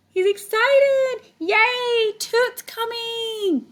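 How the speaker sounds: high, loud, slow squeal of joy; thrilled yay, stretched out; excited. Acted. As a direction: cheer like a kid who can't wait. Teacher voices Puddle's excitement.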